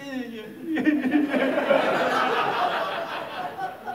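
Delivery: high, loud mock weeping; hammed-up wailing sob; mock crying. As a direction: imitate tearful whimpering rising to a wail. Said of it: "I start crying".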